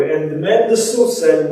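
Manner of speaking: normal talking voice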